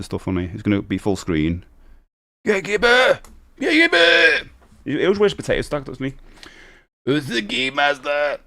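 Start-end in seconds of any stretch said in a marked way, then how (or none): 2.4-4.5 high put-on squawk, climbing higher; gatekeeper. Streamer repeats the gatekeeper's cry, high and hammy. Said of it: "He sounds like that". 7.1-8.4 high, slow put-on voice; game master character. Streamer plays the game master, high and hammy.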